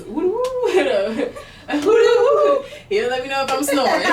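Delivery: high, loud laughing through the snore noise. Retold or imitated. Laughing to a friend about her snoring, high and loud. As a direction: giggle out the woo-woo snore sound.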